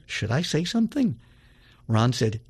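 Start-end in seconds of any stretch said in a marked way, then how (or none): none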